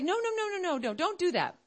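high, hurried no's, dropping at the end; urgent motherly warning; pleading mother. Acted. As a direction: beg a child to stop. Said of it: "And the mother's like".

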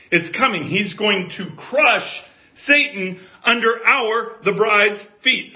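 loud, high, slow pulpit promise; emphatic sermon climax; full-force preaching. Real, not acted. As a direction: declare the coming victory, phrase by phrase.

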